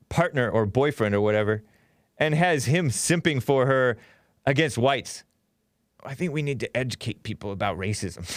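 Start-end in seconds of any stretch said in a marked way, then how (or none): 6.0-8.2 lightly put-on Valley girl voice, quick and soft; mocking the boyfriend's speech, a bit quick and softer. Mocking a politician's boyfriend: light Valley girl voice. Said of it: "And he sounds just like her".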